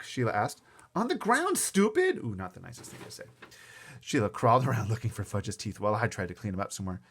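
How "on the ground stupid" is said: put-on high, loud boyish jab; annoyed retort. A narrator's reading. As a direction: snap back at a silly question. Boy snaps insult at girl.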